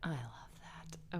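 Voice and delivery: slow soft whisper. Real, not acted. Cohost softly reacting to the song pick, whispered. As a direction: breathe it fondly.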